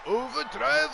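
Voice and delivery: Overdrive! shouted high and loud in a hammed-up Japanese accent; mock Japanese accent, big loud shout. Shown as a demonstration. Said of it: "said in a Japanese accent".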